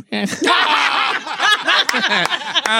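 put-on cackling old man laugh. Shown as a demonstration. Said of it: "voz de viejito".

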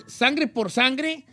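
ordinary talk, nothing marked

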